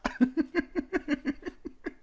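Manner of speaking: a high burst of laughter. Real, not acted. Co-host laughing at his own villain line, high laugh. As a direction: laugh off the joke. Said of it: "laughs after joke".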